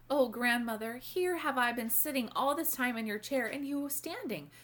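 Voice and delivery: put-on a girl suddenly embarrassed; flustered. A narrator's reading. Irene jumps up, alarmed.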